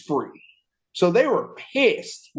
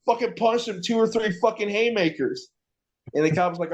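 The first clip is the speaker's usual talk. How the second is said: ordinary delivery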